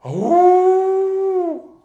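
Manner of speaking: high loud howl on oh; wolf howl. Pretend. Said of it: "I have to enact a wolf".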